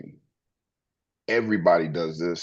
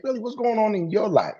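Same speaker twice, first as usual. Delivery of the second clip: much higher, bright eager question; eager interest; 13 semitones higher than usual. Retold or imitated. The speaker asking a happy stranger about their life, high eager tone. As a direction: lean in, delighted to hear more.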